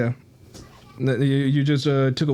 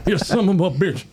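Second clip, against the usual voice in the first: higher, put-on Bernie Mac voice; Bernie Mac impression; 3 semitones higher than usual. Doing his best Bernie Mac to the friend. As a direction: channel Bernie Mac's bluster. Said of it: "My Bernie is my Martin".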